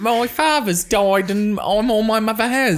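high, slow little-urchin voice; hammed-up pitiful child; pretend child. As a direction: plead like a poor Edwardian orphan. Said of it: "pass for a child in nineteen twelve".

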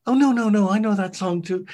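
high, loud mock reassurance; imitated lady guest. Guest voicing a lady answering the host, high mock reassurance. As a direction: play the obliging lady, pitched well up. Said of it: "And they go".

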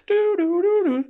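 high sing-song alarm bell noise; alarm imitation. Prison officer mimics the alarm bell for a listener, high sing-song.

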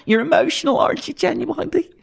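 high, syrupy interviewer pressing for tears; Miriam O'Callaghan impression. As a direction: coax the guest into crying. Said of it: "where I'm Miriam O'Callaghan".

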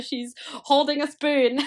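high, slow She's holding a spoon with holding drawn out; gushing mock awe. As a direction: swoon over the spoon like a miracle.

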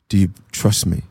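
the other voice. Retold or imitated. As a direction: voice a divine question, low and measured. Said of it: "this other voice said".

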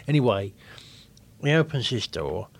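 normal talking voice

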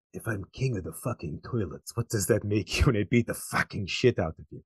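put-on low, soft Eastern European accent; quiet, low Boyka-style accent. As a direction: growl a quote in mock Eastern European accent. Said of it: "It's a unique accent too".

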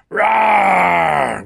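high, loud drawn-out "rawr"; big long shouted roar. A narrator's reading. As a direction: roar it out like a stage monster. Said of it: "went the rotter".

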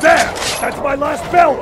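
loud urgent yell; shouting. Acted. Battle, last shot.

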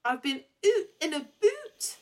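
slow, high mock Scottish accent; bad Scottish accent. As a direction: stretch out an awful Scottish accent. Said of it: "Billie Piper's actual Scottish accent".

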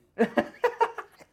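squealing high laugh. Real, not acted. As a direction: burst into a shrieking laugh. Big laugh.

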